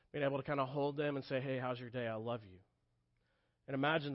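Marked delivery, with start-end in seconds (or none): 1.4-2.6 put-on softer, gentle words to a child; father murmuring softly to his child at bedtime; tender. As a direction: hush it like a bedtime hug. Father holding child at night.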